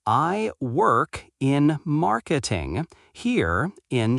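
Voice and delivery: slow, careful model sentence, x0.7; slow repeat. Shown as a demonstration. Teacher repeats slowly for learners, slow and clear. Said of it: "repeat slowly".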